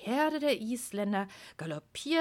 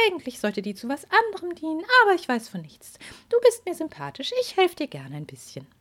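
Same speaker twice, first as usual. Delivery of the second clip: fairy voice; 9 semitones higher, 3 dB louder than usual. Acted. Fairy speaks.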